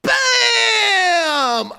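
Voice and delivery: high, loud, drawn-out victory yell; triumphant shout. Pretend. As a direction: blast it out long and high. Crowing after the acted apology.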